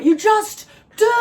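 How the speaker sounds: high, slow, shouted just do it; over-the-top hype shout; Shia LaBeouf. Retold or imitated. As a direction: yell it like a frantic motivational speech. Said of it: "it, Shia LaBeouf".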